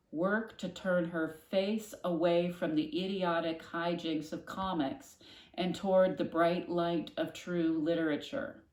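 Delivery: ordinary delivery, nothing marked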